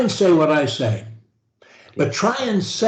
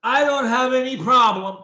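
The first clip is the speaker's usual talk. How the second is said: with energy; 5 semitones higher, 3 dB louder than usual. Shown as a demonstration. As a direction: sell it with energy and conviction. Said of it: "the way I do, with energy".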